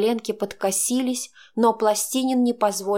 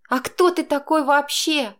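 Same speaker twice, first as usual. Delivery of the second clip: defiant; 6 semitones higher, 3 dB louder than usual. A narrator's reading. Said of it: "бросила я".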